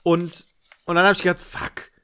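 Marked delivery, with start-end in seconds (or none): 1.4-1.9 whispered curse. Retold or imitated. Inner curse in retold thought.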